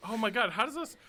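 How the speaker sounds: normal talking voice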